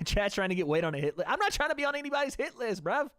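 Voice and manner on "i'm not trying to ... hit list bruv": squeaky protest. Real, not acted. Jokey protest at chat.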